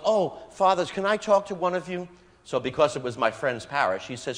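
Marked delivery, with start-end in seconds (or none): 0.1-2.1 raised, louder eager appeal to two priests; eager call to priests. Retold or imitated. Woman in the rectory hails two priests, eager and raised. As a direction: call out to catch the fathers' attention.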